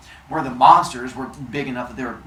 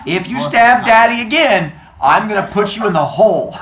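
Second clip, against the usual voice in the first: scolding parent; 7 semitones higher, 8 dB louder than usual. Acted. Parent scolding children at home.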